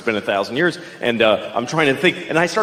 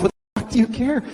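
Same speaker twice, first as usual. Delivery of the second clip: ordinary delivery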